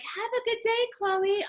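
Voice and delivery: high bright sing-song farewell; high cheerful send-off; cheery mom goodbye. Retold or imitated. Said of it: "So as I'm going, like".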